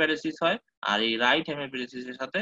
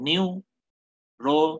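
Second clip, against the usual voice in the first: Neurology broken into slow separate syllables, ni-u-ro-lo-ji; 4 dB louder, much slower than usual. Shown as a demonstration. Lecturer demonstrating Broca's aphasia speech to students, halting syllables. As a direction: stretch neurology out one syllable at a time, halting. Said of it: "এইভাবে বলবে".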